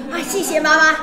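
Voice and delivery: loud, high mock gratitude; sarcastic thanks. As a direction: thank mum with heavy sarcasm. Interpreter voices daughter's ironic thanks.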